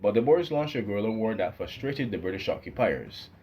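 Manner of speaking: ordinary delivery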